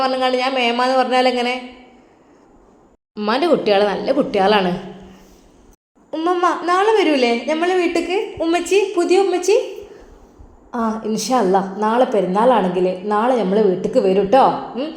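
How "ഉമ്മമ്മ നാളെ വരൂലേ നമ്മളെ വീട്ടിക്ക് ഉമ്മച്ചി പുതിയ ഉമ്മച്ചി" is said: put-on high, childlike voice scolding grandma; little girl voice. Little granddaughter correcting grandma, high childlike voice. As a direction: play a small girl correcting her granny.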